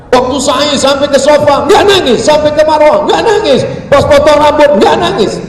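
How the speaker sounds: loud, high-pitched insistent list; exasperated recounting. Retold or imitated. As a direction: hammer each failure to cry. Mounting complaint about the man not crying.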